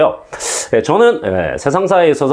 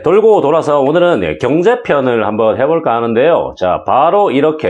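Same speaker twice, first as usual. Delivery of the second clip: usual speaking voice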